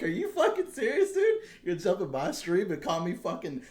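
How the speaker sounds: high, laughing, outraged retelling; laughing disbelief. Retold or imitated. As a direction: laugh through the outrage at the troll. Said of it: "I couldn't fucking believe it".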